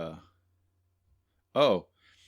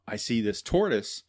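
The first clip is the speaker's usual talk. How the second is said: ordinary delivery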